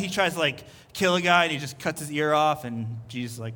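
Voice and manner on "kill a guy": ordinary delivery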